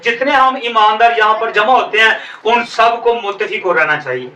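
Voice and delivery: ordinary talk, nothing marked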